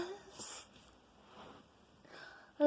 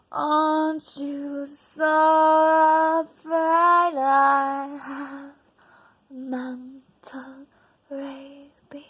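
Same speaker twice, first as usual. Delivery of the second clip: normal talking voice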